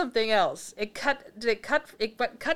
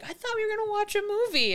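raised pitch, a little pleading; high whiny voice; 12 semitones higher, faster than usual. Retold or imitated. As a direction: play the boyfriend high and whiny. Man voiced in retold scene.

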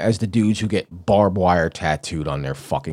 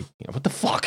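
ordinary talk

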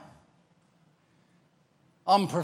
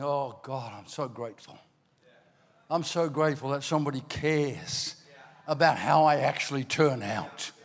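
much softer, quiet heartfelt thanks; grateful prayer; 6 semitones lower, 6 dB quieter than usual. Retold or imitated. Older man thanking God for correction, softly grateful. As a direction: hush it like a tender thank-you.

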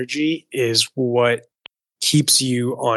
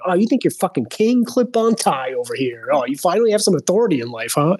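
mock sneering rant; 6 semitones higher than usual. Rant at the parking attendant.

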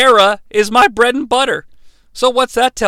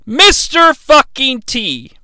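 emphatic declaration; 5 semitones higher, 6 dB louder, slower than usual. Real, not acted. Declares Mister T stays.

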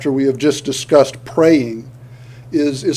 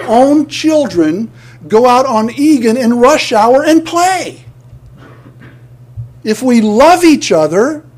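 impassioned preaching; 6 semitones higher than usual. Acted. Preacher pleading with the congregation about loving each other, rising and impassioned. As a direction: plead with them, voice climbing high.

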